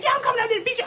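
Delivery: high-pitched caller threat; squealed fighting words; mock angry threat. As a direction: imitate a furious woman on the phone.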